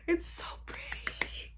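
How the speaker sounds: breathy hushed awe, slowed; awed whisper. Real, not acted. Unboxing her new laptop, a slow awed whisper. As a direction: whisper it like it's precious.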